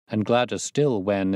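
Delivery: plain reading voice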